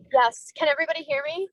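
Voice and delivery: normal talking voice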